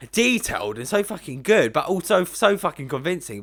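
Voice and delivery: normal talking voice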